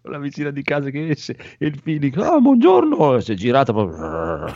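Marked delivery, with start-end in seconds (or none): 2.2-3.2 high sweet greeting. Retold or imitated. Neighbour greeting retold.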